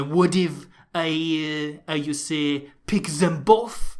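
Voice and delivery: slow, hammed-up French accent; mock the coach in a cheesy French accent; a coach's eureka moment in a hammy French accent; bad French accent. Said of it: "I should never do that accent again".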